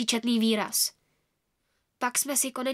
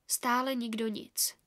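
ordinary delivery

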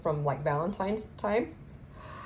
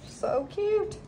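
much higher, squeaky So cute; a real burst of delight, pitched way up; excited squeal; 13 semitones higher than usual. Real, not acted. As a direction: squeal it like you just saw a puppy. Squealing over a cute sticker.

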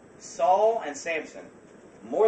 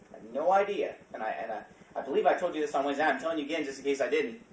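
ordinary talk

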